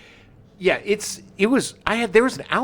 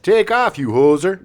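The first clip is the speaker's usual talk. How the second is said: loud, higher Canadian hoser line; a loud, higher Canadian hoser catchphrase; 7 semitones higher, 6 dB louder, slower than usual. Retold or imitated. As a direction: toss it off like a McKenzie brother. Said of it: "Lines from the sketch such as".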